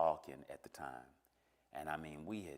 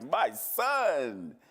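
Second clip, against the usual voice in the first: big, high, loud proud cry; booming, drawn-out fatherly welcome; overjoyed father; 7 semitones higher, 10 dB louder than usual. Retold or imitated. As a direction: call it out like a long-awaited reunion. Imagined joyful father greeting him.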